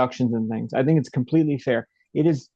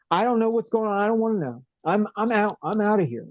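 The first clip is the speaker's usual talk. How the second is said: imitated flustered officer, high-pitched; retold panic; 7 semitones higher than usual. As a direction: mimic someone panicking and backing out, voice raised in pitch. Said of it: "he, like, panics and he goes".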